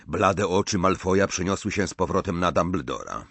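ordinary delivery

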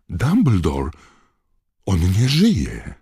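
high, loud, horrified cry, dragging the last words. Acted. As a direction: cry out in shock. Fudge horrified at the death.

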